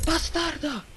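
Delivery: high startled cry; startled shriek. Real, not acted. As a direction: shriek it in fright. Jump scare in horror game.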